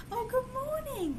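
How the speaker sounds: high, slow sing-song morning greeting; sweet pet talk. Retold or imitated. Greeting her stretching dog, in a high slow coo. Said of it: "she stretches and I say".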